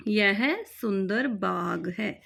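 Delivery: यह सुंदर बाग है said slowly and clearly; slow dictation. Shown as a demonstration. Teacher dictating the translation slowly. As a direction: spell it out slowly for the class. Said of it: "repeated slowly for students".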